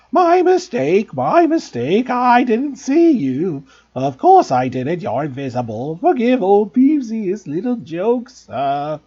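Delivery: high, loud, slow oily grovelling; greasy poltergeist. Acted. Peeves grovelling to the Bloody Baron, greasy and high. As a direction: fawn over the Baron like a toady. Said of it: "he said greasily".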